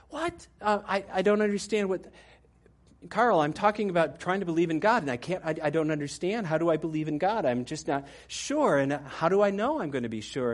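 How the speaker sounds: startled high "What?", then bewildered pleading; confused student. Retold or imitated.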